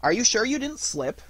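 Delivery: high, knowing question; know-it-all suggestion; wisely. A narrator's reading. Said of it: "asked Rabbit wisely".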